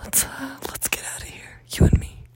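breathy intimate whisper; seductive whisper. Pretend. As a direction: whisper it like a come-on. Teasing fake seductive whisper.

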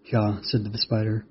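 ordinary delivery, nothing marked

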